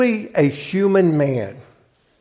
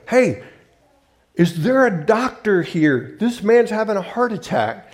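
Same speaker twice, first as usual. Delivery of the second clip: raised, urgent call across the stands, higher; calling out for help; 7 semitones higher than usual. Retold or imitated. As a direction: shout to the crowd for a doctor. Stranger calls out at football game.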